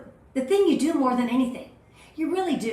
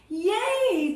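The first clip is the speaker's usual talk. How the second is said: high squeal of delight; excited cheer; 8 semitones higher than usual. Real, not acted. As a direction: cheer like a friend just arrived. Cheering as viewers join.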